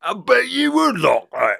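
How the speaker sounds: high, loud hammed-up leer; mock taunt. Bulldog taunting Constantine, loud and high. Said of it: "maybe that he's like".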